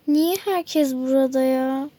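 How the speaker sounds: ordinary talk, nothing marked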